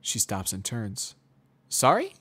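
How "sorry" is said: high, loud surprised question; a sudden high turn-back; surprised mare. Acted. Twilight turns back.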